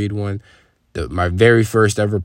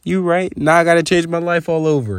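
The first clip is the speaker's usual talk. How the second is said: conceding; 8 semitones higher than usual. Retold or imitated. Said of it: "And I'm just like".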